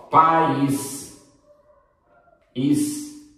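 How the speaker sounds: país as pa-ÍS, the ís stretched and repeated; split syllables. Shown as a demonstration. Teacher shows where the accent falls, país split and stretched. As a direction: pull país into two beats, stressing ís. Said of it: "acento agudo".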